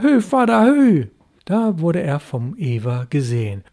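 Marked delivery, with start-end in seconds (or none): 0.0-1.1 loud high call across the water; boy calling out to his father's ship. A narrator's reading. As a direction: hail your father from the boat. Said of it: "wie rief er".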